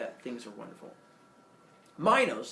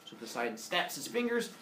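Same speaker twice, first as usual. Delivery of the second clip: usual speaking voice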